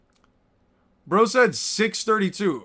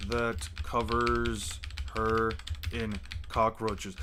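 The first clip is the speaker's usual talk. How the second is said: much lower, slow movie-trailer narration; deep, slow, menacing storyteller; dramatic narrator voice; 10 semitones lower, 14 dB quieter, much slower than usual. Pretend. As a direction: intone it like an ominous trailer voice.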